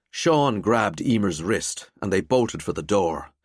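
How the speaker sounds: ordinary delivery, nothing marked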